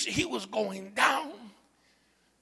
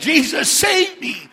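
high, slow, loud plea; crying out; 9 semitones higher, 16 dB louder, slower than usual. Acted. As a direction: shout it out like a drowning man's plea. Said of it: "He cried out, church".

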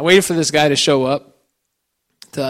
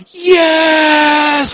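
put-on child's cheer; 12 semitones higher, 7 dB louder than usual. Daughter gets the tree.

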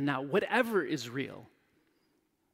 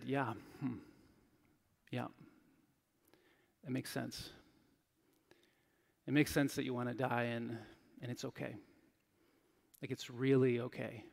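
gentle reassurance; 4 semitones lower, 8 dB quieter than usual. Retold or imitated. Chaplain gently validating dying patient.

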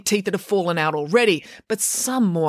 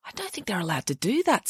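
whispering; 2 semitones higher, 6 dB quieter than usual. Acted. Samantha objects timidly.

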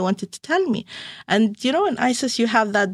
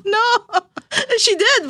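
admitting it through a laugh, pitched up; 11 semitones higher, 3 dB louder than usual. Real, not acted. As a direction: giggle while you confess it. Laughing while admitting daughter's attack.

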